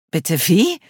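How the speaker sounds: baffled question; surprised. Acted. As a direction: caught off guard. Cleo stunned by request.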